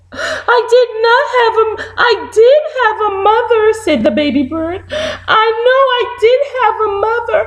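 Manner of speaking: very high, fast crying chick; high hammed-up weepy vow; tearful baby bird. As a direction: sob out the promise.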